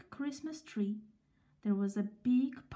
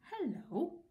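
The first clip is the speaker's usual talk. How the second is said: surprised hello; 6 semitones higher, 5 dB quieter than usual. Acted. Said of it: "cried a voice".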